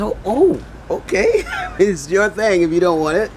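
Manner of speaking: high, loud, laughing backing-off; mock taken aback. Passerby answering the beggar who refused his coin, high mock acceptance. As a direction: back off in high-pitched amused disbelief. Acted reaction to being refused.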